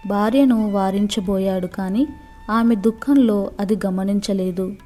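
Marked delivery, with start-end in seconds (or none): none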